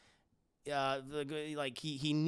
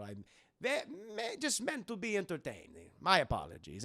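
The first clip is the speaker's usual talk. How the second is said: Dracula; 4 semitones lower than usual. Retold or imitated. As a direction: apologize like a courtly foreign count. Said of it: "He's like".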